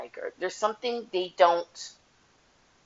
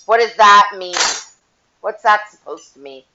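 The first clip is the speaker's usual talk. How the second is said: loud, indignant snap; offended outburst; 12 dB louder than usual. Retold or imitated. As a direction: bark it back, offended. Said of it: "Then they're like".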